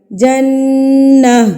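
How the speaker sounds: جنہ very slow with nasal نون; ghunna, stretched. Shown as a demonstration. Teacher stretches the ghunna in جنہ. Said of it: "تب ہم غنہ کریں گے".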